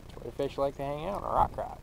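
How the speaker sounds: ordinary delivery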